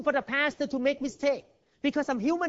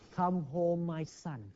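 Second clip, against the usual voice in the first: gentle father; 6 semitones lower, 8 dB quieter, slower than usual. Acted. Father welcomes prodigal son home.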